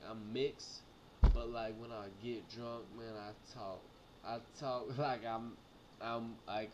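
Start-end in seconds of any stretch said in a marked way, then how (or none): none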